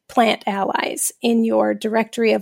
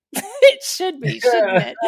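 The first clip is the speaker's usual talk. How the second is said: mock bitter reply; slower than usual. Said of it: "And I'm like".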